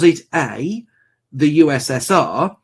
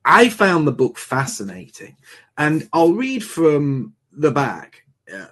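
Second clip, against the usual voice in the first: normal talking voice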